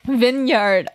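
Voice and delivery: Vineyard as VIN-yard, falling; correct pronunciation. Shown as a demonstration. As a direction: settle on Vineyard with a short "i". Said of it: "who decided that vineyard is pronounced vineyard".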